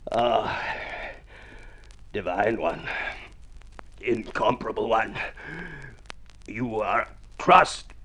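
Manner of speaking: hoarse, slow romantic pleading; lost voice. Acted. Judd woos the senorita with his voice going, slow and croaky. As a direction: try to woo with a voice that is failing. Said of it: "What has happened to your so wonderful voice".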